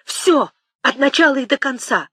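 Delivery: moderately loud acted cry. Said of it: "крикнул Темляков".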